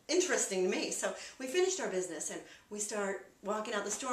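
usual speaking voice

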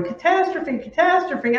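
hammed-up panic over a scary card; mock panic. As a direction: shriek it like your hair is on fire. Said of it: "our hair on fire going".

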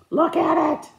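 high, loud indignant cry; exasperated outburst. Real, not acted. Crafter showing viewers the botched bedpost, indignant. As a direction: burst out in real exasperation. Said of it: "Oh, I was so mad".

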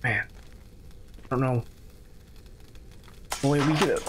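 usual speaking voice

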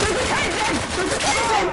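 high, loud warning callout; urgent callout. Real, not acted. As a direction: warn the team fast. Alerting teammates in game.

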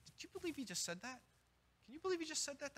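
mock scandalised whisper-like gossip; gossiping snake. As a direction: sneer under the breath to a neighbour. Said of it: "a snake sitting in the pew going".

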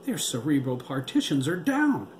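high, loud, rising alarm; alarmed outcry. A narrator's reading. Doctor reports failed partitions to the team, panicked. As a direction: call out the failure in mounting panic.

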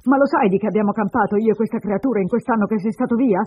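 jeer, then build into an angry reproach; sarcastic. Acted. Woman reproaches runaway husband, sarcastic and bitter. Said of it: "Lei riprese sarcastica".

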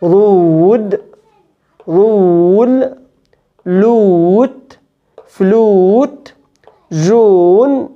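رول said slowly with a pure "oo" and no "y"; plain long "oo". Shown as a demonstration. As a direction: keep "oo" pure, no "y", in رود، رول، لوت، فلوت، جون. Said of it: "او بس بدون يا". (أو من غير يا)

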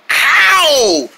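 high, falling "ow"; acted pained yelp. Joe colliding with the Dearly Yangs, yelping.

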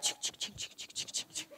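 soft unvoiced ching ching imitating tiny cymbals; whispered cymbal chings. Pastor recalls playing cymbals in third grade, whispered chings. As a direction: tap out the cymbal noise in a whisper. Cymbal sound while in back.

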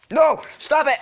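high, loud cry of protest; sudden high gamer panic; panicked yell. Real, not acted. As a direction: shout frantically to stop the attacker. Attacked in the game.

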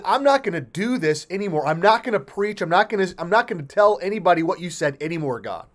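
high, loud, fed-up protest; acted exasperated outburst; defiant refusal. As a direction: tell God you quit, rushing as it builds. Voices Jeremiah refusing to preach further.

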